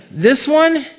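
surprised "this one?"; incredulous Samuel. Acted. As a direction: question the choice in disbelief. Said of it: "and Samuel's like".

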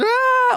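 high, loud "ah" of mock alarm; exaggerated freak-out yelp; mock panic scream. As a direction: burst into an overwhelmed cry. Mock panic at piled-up troubles.

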